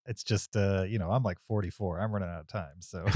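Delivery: usual speaking voice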